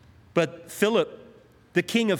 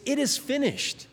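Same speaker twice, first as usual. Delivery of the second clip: victory cry; 9 semitones higher than usual. Retold or imitated. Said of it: "Words of victory".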